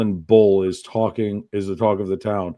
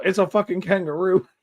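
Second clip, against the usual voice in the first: mock yell, pitched higher; retold yell; 9 semitones higher than usual. Grandmother spotting a kangaroo on the road, mock yell. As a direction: shout it like a startled grandmother. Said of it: "I heard my grandmother yell".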